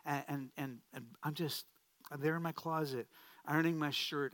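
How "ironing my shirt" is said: ordinary talk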